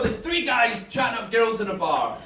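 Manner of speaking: usual speaking voice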